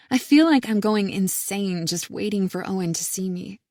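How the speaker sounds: ordinary delivery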